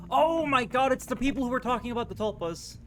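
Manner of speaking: high excited gasp; loud, high excitement. Real, not acted. Excited gamer reaction.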